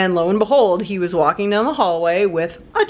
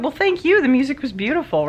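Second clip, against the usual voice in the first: much higher, gushing thanks; sweetly grateful; 7 semitones higher than usual. Retold or imitated. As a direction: gush your thanks up high. Her reply to the cellist.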